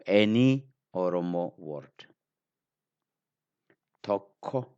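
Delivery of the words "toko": Toko with a doubled k, held before release; strong sound. Shown as a demonstration. Teacher modelling a word for learners, strong k. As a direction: stop on the k in Toko, then release. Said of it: "I say it deeper".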